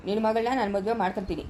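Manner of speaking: ordinary talk, nothing marked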